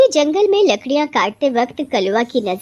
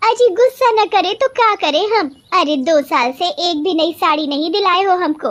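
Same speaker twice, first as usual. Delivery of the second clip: high-pitched, exasperated wife; angry complaint; 7 semitones higher than usual. Acted. Wife complains to husband about no new sari, angry. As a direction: throw the grievance back at him. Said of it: "काहे को इतना गुस्सा करती हो".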